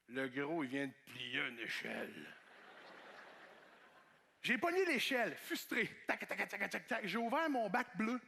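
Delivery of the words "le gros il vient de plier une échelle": mocking coworkers. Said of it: "ils faisaient".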